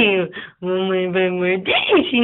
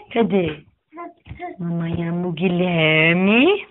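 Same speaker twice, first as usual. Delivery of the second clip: ordinary talk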